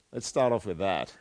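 normal talking voice

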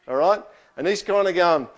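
ordinary talk

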